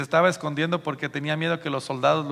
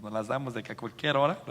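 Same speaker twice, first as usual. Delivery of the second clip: ordinary talk, nothing marked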